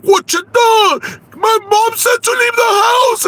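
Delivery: shrill very high yell; mock screaming. The mother-in-law screaming her out of the house, very high. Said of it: "you got so mad".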